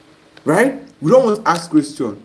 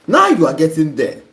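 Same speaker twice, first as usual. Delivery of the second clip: normal talking voice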